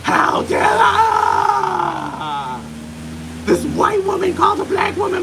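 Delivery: high, loud, offended caricature; mock outraged American. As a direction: parody a scandalized stateside woman at full shriek.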